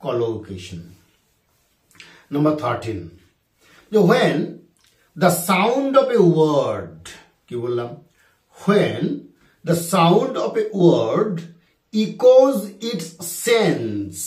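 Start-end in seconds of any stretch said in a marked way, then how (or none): 4.1-14.2 drawn-out dictation pace; slow dictation. Shown as a demonstration. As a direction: dictate each word slowly for the class.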